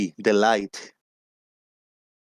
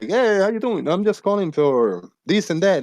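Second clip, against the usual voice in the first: cold caller; 9 semitones higher than usual. Retold or imitated. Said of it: "Some dude".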